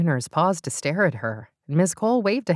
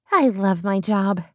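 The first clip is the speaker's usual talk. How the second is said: character voice; 4 semitones higher, slower than usual. Acted. Beth in train costume.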